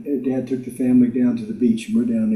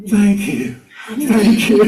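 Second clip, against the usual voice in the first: higher, louder delighted thank you; excited thanks; 6 semitones higher, 5 dB louder, slower than usual. Retold or imitated. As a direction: burst out thanking the heron. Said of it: "there going".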